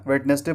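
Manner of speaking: "वेडनेसडे" as wed-nes-day; common mispronunciation. Shown as a demonstration. Teacher calls this version wrong, three-syllable "wed-nes-day". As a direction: say "वेडनेसडे" with d and middle syllable sounded. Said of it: "बोलना गलत है".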